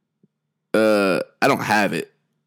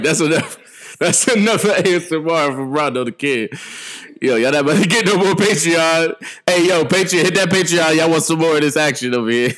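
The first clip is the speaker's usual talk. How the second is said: hype man plug; 6 semitones higher than usual. Acted. Hyping the Patreon to listeners.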